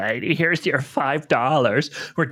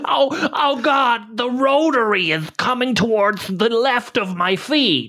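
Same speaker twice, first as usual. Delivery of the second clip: high, hammed-up panic over "feet"; shrieking in silly fake terror; mock panic; 11 semitones higher than usual. Mower attacks her feet.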